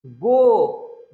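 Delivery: "गो" with a long, loud O; stress on the vowel. Shown as a demonstration. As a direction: hold and push the O in "गो". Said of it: "दबाव कहाँ है?".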